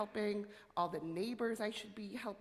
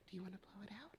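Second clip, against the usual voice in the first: ordinary talk, nothing marked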